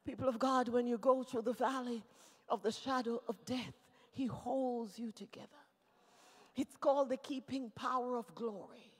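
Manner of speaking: very soft, low voice; hushed preaching. Real, not acted. Preacher comforting people of God in the valley, hushed. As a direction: settle into quiet comfort after the shout.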